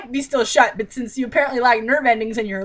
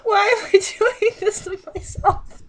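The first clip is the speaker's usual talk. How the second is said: much higher, slow whiny lament; mock despair; 10 semitones higher, slower than usual. Real, not acted. Reader to viewers, lamenting having to read on. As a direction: wail it in mock agony.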